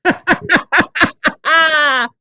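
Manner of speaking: big, high staccato cackle; loud laugh. Real, not acted. Podcast host cracking up at the co-host, loud high laughter.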